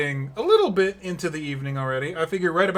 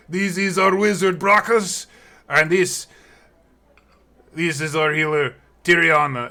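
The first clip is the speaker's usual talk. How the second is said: raised, louder announcing voice; proud introduction; 3 semitones higher, 6 dB louder than usual. Acted. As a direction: present the party with fanfare. Introducing party members in character.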